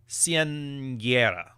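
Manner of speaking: quinceañera drawn out slowly, unsure; careful attempt. Shown as a demonstration. Podcaster admitting he can't say it right. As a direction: stretch quinceañera out, testing it. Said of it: "I know I'm not pronouncing it right".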